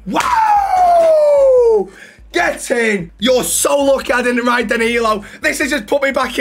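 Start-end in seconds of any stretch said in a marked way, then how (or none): 0.1-1.9 high, loud cheer; shrieking wow of glee; excited whoop. Real, not acted. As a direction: yelp with delight. Reacting to lucky pick.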